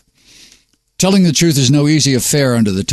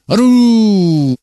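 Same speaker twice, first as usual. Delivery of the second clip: a high, loud, rising howl; dog howl; 10 semitones higher, 4 dB louder than usual. Acted. The Dog Poet howls goodbye to his listeners, a loud high dog howl. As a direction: throw back your head and howl like a dog.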